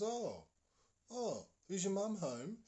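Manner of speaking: high voice, drawn-out "oh"; puzzled neighbour. Retold or imitated. Neighbour asks boy about his mum, high puzzled voice. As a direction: imitate the neighbour asking, pitched well up.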